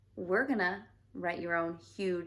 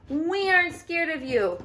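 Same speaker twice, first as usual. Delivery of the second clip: higher, louder brave shout; defiant shout, a bit higher and louder; 7 semitones higher, 6 dB louder than usual. Acted. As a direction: call out bravely at the enemy. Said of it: "They shouted".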